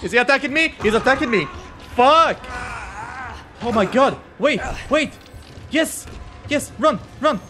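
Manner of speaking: high, loud panicked shouts; panicked yelling. Real, not acted. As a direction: scream as it lunges at you. Attacked by a monster in game.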